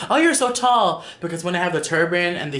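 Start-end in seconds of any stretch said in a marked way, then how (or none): 0.1-1.0 high-pitched mock amazement; amazed new acquaintance. People meeting the vlogger in heels, mock amazement. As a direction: imitate people gushing, pitched way up. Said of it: "When people meet me, they're like".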